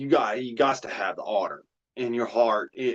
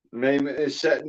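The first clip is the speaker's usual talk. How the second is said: normal talking voice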